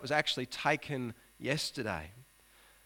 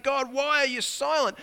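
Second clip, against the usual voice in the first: louder, higher demand; angry complaint; 10 semitones higher, 7 dB louder than usual. Acted. Preacher voicing Habakkuk's angry complaint to God, louder. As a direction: shake a fist at God. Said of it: "raising his fist at God".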